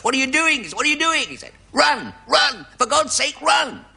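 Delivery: panicked cricketer shouting run; batsman shouting. Acted. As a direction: yell at a partner who won't run. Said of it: "The batsman shouts".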